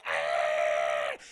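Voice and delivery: a groaning yell into the abyss; deep falling scream. Retold or imitated. Elsa slips into abyss.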